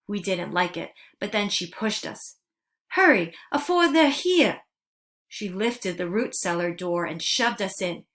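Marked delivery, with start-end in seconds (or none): 2.9-4.6 high, loud, hurried urging; urgent shout. Acted. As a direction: push them to hide now, loud and high. Momo Bay pushing children to hide.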